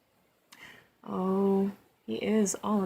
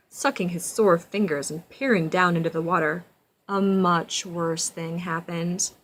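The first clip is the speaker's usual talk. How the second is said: building louder toward the snap; dramatic storybook narration; 8 dB louder than usual. A narrator's reading. Reading to a child, suspenseful rising narration. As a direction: read it as a scary climax.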